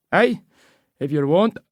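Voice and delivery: put-on high, persuasive old local; old Scottish man. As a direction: coax her like a cheeky old seller.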